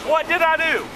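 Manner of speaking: loud indignant questioning; angry protest. Real, not acted. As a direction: demand an answer, loud. Protesting assault accusation.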